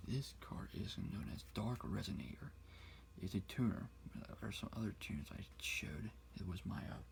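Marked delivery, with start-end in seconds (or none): 0.1-2.5 soft unvoiced whisper; whisper in left ear. 4.1-6.1 soft ASMR whisper; whisper in left ear. Acted.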